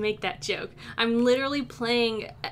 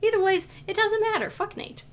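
defiant, high and loud; 8 semitones higher, 7 dB louder than usual. Acted. Character brushing off teacher.

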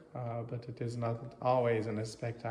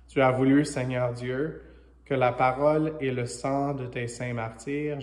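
louder, measured liturgical proclamation; prayer reading in French; 4 dB louder than usual. Real, not acted. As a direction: proclaim a prayer to God, phrase by phrase. Reads the French prayer aloud.

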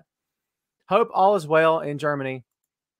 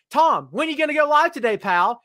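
mock fan message; 8 semitones higher than usual. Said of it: "I get inboxed all the time".

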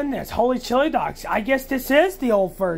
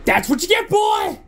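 angry shout; 8 semitones higher than usual. Acted. After hitting the cat.

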